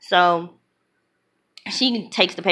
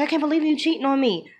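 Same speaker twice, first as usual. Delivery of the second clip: higher-pitched mock outrage at a betrayal; retold hurt accusation; 8 semitones higher than usual. Girlfriend confronting her cheating boyfriend, retold indignant accusation. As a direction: accuse him in a raised pitch, retelling it.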